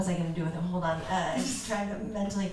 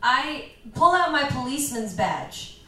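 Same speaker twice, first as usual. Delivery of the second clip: ordinary delivery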